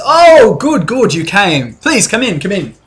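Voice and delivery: high, bright, eager welcome from the host; host Marcus. Acted. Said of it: "He says".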